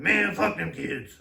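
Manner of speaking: loud, slowed-down dad impression; gruff-talking dad. Son imitating his tough woodsman dad, loud dad voice. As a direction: drag it out loud in dad's voice. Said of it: "guys that talks like this".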